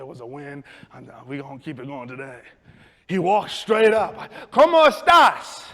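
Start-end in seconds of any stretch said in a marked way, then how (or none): 4.5-5.7 loud, high, drawn-out Spanish; hammed-up eager greeting; overconfident greeting. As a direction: belt it out cheerfully at a customer. Greets new customer confidently.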